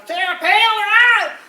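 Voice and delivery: high, loud, slow yelp of her name; mock shriek. Comedian yelping at Sarah Palin's name, high and loud.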